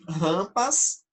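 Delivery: normal talking voice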